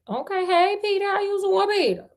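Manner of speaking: high, flirty greeting. Pretend. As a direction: flirt with him sweetly.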